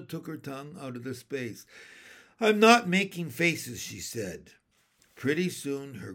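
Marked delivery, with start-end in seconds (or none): 2.4-3.8 loud, high protest; indignant denial. A narrator's reading. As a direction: deny it hotly. Ramona denying to mother.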